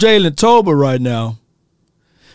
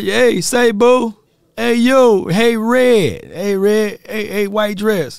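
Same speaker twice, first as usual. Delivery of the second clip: mock catcall; 5 semitones higher, slower than usual. Acts out men catcalling a woman.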